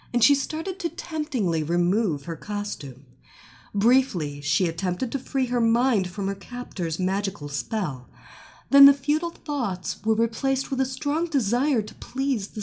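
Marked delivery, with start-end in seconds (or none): none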